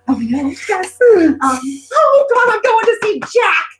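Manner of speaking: loud, high fan squeal of words; gushing excitement. Real, not acted. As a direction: burst out like a thrilled fan.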